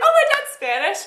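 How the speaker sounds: high, bright naive voice quoting her younger self; lightly voiced childish boast. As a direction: mimic a proud little kid. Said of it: "I was like".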